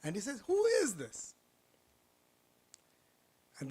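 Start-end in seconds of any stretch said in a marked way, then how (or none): 0.5-1.3 startled. Acted. Potter startled by unseen voice.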